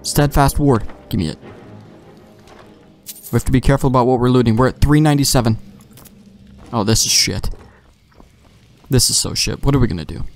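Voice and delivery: ordinary talk, nothing marked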